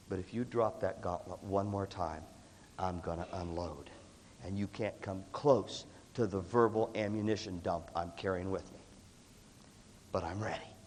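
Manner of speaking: low, slow, measured threat; quiet warning. Retold or imitated. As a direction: drop low and slow, holding back a threat. Retold warning to rival colleague.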